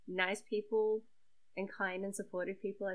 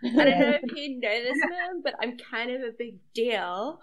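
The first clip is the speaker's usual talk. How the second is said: loud, higher mock-celebrity boast; mock self-important; 4 semitones higher, 11 dB louder than usual. New celebrity daughter boasting to Mom, mock self-important. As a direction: brag grandly, hamming it up.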